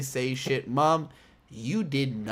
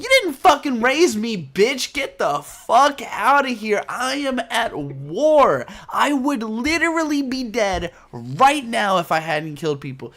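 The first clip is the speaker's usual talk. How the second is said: much higher, loud yelling rant; furious son; 9 semitones higher, 8 dB louder than usual. Retold or imitated. Amuro yelling at his mother, loud and high. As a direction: scream it back at her. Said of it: "Amuro's like".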